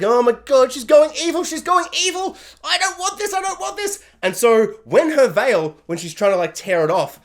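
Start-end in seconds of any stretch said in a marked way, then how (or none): 0.0-4.0 high, rising panicked alarm; panicked fan. Retold or imitated. Viewer watching the veil turn evil, high panicked alarm. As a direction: freak out as it goes wrong. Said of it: "and I'm like".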